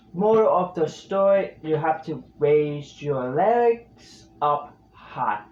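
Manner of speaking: usual speaking voice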